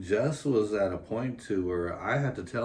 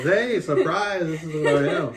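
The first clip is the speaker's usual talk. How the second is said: put-on baby voice; 9 semitones higher, 9 dB louder than usual. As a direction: play the newborn shouting its big reveal, giggling. Baby announcing itself at birth.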